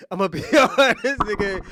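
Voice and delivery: bust out laughing mid-sentence. Real, not acted. Teasing Slimmy with brutal honesty, laughing. Cracking up while teasing friend.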